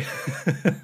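airy giggle; breathy laugh. Real, not acted. Laughs at herself.

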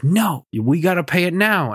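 a touch of a shrill demand from the wife; mock angry wife. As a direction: imitate the wife demanding payment at the vet. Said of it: "make a whole ass fu-, scene".

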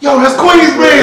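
high, slow and loud amazement; amazed recognition. Retold or imitated. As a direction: drag it out in disbelief at the place. Recognizing the buildings.

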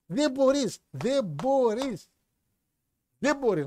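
high, drawn-out disbelief, slowing hard on the repeat; exasperated protest. Real, not acted. To the co-host about the promo, exasperated. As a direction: stretch the second μπορείς out in dismay.

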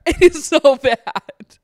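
slow, loud laughing groan. Real, not acted. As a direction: laugh it out in disbelief. Laughing at the mess.

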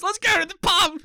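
high, loud hammed-up call to the pub; rally the team off to the pub; cheery drunk bloke. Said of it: "it's always".

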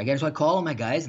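ordinary talk

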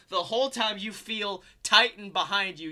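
ordinary delivery

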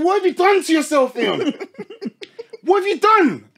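high, loud horrified mum; shocked mum. Retold or imitated. Imitating his mum shocked at his hairline, high and loud. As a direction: shriek it like a horrified mother. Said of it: "she said".

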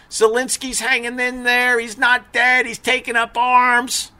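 high, hopeful mock cheer; naive optimist. Mock optimist imagined quote.

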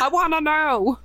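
loud, high mock whine; exasperated retold yell. Desperate to know what's in the box, exasperated yell. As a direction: wail it in playful frustration. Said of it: "at work going".

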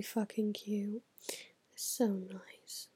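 soft slow whisper of affection; whispered gushing. Real, not acted. Vlogger whispering how cute both Bens are. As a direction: whisper it fondly.

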